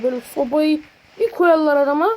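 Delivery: ordinary delivery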